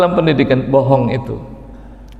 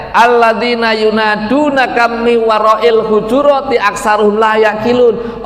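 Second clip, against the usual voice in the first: raised chanting recitation; Quran recitation; 6 semitones higher than usual. Shown as a demonstration. Preacher quoting verse to congregation, recited.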